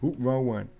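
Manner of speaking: ordinary talk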